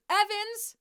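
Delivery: loud desperate call; shouting. Acted. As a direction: yell her name after her. Calling after her.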